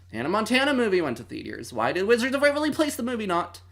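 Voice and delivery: fast, high-pitched indignant complaint; mock outrage. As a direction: rattle off the injustice, pitch climbing. Mock outrage at unfair movie release.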